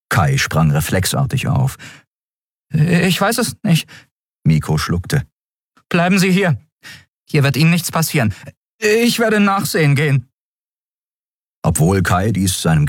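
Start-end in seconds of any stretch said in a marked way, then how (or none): 2.7-3.9 high, lightly voiced anxious boy. A narrator's reading. Miko answers Kai after the roar, anxious boy. 5.9-10.3 put-on high-pitched boy, a touch urgent; anxious boy. A narrator's reading. Miko tells Kai to stay behind, anxious boy.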